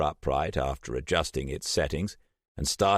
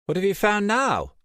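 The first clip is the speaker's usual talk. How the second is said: much higher, curious woman's voice; Trish's voice; 5 dB louder than usual. A narrator's reading. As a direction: Trish calling out, puzzled.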